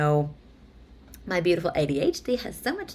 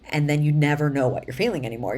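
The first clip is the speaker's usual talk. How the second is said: ordinary delivery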